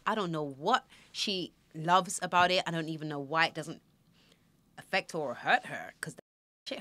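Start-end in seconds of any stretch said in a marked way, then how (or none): none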